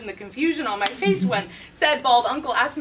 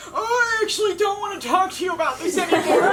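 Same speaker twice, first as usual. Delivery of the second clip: higher, louder, fast mock whimper; whimpering defiantly; 4 semitones higher, 5 dB louder, faster than usual. Uncle bowing out of the talk, mock whimpering defiantly. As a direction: whine out of it like a cornered uncle. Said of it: "whimpering defiantly".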